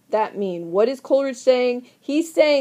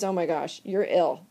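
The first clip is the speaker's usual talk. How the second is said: low, much softer worried voice; hushed concern; 6 semitones lower, 6 dB quieter than usual. Acted. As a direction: drop your voice in shocked concern. Henry shocked and hushed.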